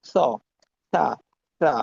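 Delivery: স and সা as a soft th, tongue between the teeth; soft lisped s. Shown as a demonstration. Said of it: "soft একটা উচ্চারণ হবে". (দাঁতের মাঝে জিভ রেখে নরম সা)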